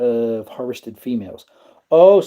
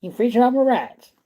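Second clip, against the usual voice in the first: even higher, indignant mock Texan; put-on Texas accent; 10 semitones higher than usual. Repeating the line to chat Moon Rocks in a higher Texan accent. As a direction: push the Texan whine up higher. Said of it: "Sound like you're from Texas".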